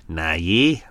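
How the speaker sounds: a light Australian lilt, rising on yeah; mock Australian accent. Host mimics the runaway husband in a light Australian accent. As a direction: tease him with a hint of Australian twang.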